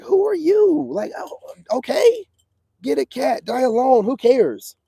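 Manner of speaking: high, scornful mock woman's voice. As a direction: squeak it out like a dismissive diva. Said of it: "I'll be like".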